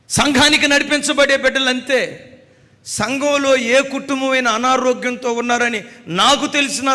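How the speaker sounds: high, loud preaching cry; fervent preaching. Acted. Preacher proclaiming to the congregation in a loud fervent cry.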